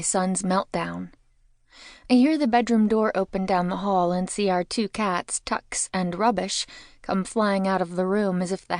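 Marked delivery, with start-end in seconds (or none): none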